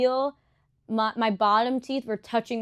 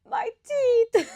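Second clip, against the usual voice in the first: high, louder mock wail; retold bawling; 14 semitones higher, 5 dB louder than usual. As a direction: imitate yourself bawling, voice leaping up. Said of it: "I just start bawling".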